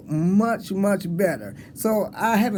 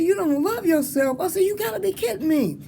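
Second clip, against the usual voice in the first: disbelief; 10 semitones higher than usual. Retold or imitated. Disbelief at student.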